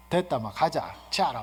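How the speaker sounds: ordinary talk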